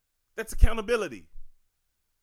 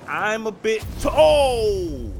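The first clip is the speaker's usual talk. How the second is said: sudden loud startled cry cutting off the sentence; 3 semitones higher, 14 dB louder, much slower than usual. Real, not acted. Host startled mid-sentence by an unexpected visitor, loud startled cry. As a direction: jump at the sudden interruption.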